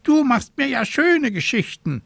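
high, loud squawking raven. A narrator's reading. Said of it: "krakeelte er".

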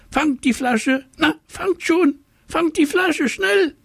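very high fish, frantic at the end; urgent fish. A narrator's reading. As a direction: hurry them, climbing in pitch. Fish urges them.

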